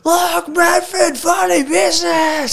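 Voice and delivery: high teasing shout; shouting at a mate to embarrass him in public; yelled out. Retold or imitated. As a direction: call it out high across the street. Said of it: "And I'm out the door going".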